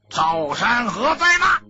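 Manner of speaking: angry roar. A narrator's reading. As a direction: storm in and roar his name. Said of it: "一声怒吼". (怒吼着喊人)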